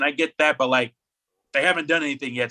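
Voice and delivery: normal talking voice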